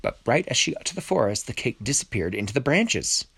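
usual speaking voice

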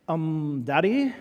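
exaggerated little-girl "Daddy?" with rising lilt; put-on daughter voice, teasingly overdone; mock daughter voice. Daughter interrupting dad in the kitchen, mock daughter voice. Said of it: "She doesn't really talk like that".

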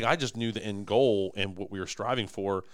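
ordinary talk, nothing marked

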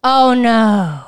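loud, drawn-out dismayed reaction; real dismay. Real, not acted. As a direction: react aghast to a friend's mishap. Listener reacts to eyelash curler mishap.

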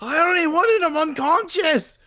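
high, loud wail; distressed protest. Real, not acted. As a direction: wail it in comic dismay. Upset that the stone killed him.

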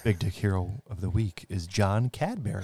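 quiet announcement. Acted.

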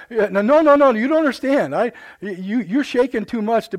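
loud, high repeated no's; insistent protest. Retold or imitated. Patient protesting to the doctor about his shaking hands, loud. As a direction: push back hard at the shaky doctor.

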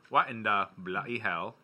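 slow mock-British accent; exaggerated British "bloody hell"; put-on British accent. Shown as a demonstration. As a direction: lay on a British accent, drawing it out. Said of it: "just like that with that accent".

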